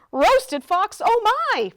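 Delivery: high, loud startled yelp; alarmed fox. A narrator's reading. Fox learns he may be eaten, alarmed. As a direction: panic in mock storybook fright.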